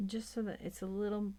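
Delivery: ordinary delivery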